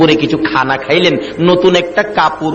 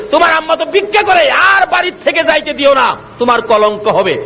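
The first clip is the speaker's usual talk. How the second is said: high, urgent telling-off; urgent scolding; 10 semitones higher than usual. Acted. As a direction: scold in a high, raised pitch. Villagers urging son against mother. (উঁচু গলায় তাড়া দিয়ে বকা)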